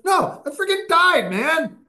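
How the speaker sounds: high, loud dude voice imitating his shock; stunned brother. Brother insisting he died.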